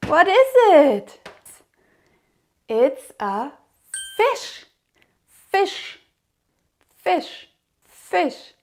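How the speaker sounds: put-on sing-song, slow toddler teaching voice; reading to a toddler. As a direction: coax little ones along. Counting and naming for toddlers.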